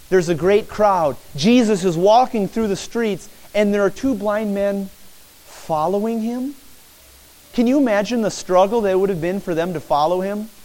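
ordinary talk

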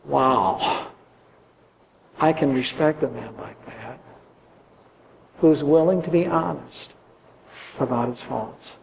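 family quietly admiring an honest father; soft admiring. Pretend. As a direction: murmur respect with awe. Family imagined admiring Isaac.

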